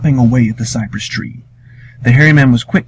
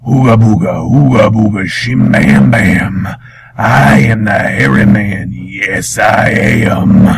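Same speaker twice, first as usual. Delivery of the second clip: slow, booming monster chant, dragging out each word; loud, drawn-out swamp monster chant; hairy man monster voice; 3 dB louder, slower than usual. Acted. Swamp monster chanting to scare Wiley, booming.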